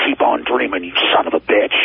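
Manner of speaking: low mock hateful growl; hateful snarl. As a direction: sneer it like a demon from The Exorcist. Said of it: "with the most hateful".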